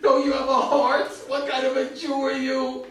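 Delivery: indignant shouting; angry shout. Retold or imitated. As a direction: rebuke him for having no heart. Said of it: "started screaming at me".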